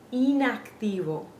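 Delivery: INACTIVO with pure Spanish ee sounds; careful Spanish INACTIVO, starting on ee. Shown as a demonstration. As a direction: model INACTIVO as ee-nak-TEE-vo. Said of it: "We're saying inactivo".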